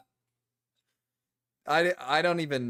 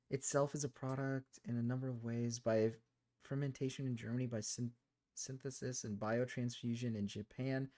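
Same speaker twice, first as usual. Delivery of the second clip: quiet reading; 8 dB quieter than usual. Real, not acted. Reading label aloud, quietly.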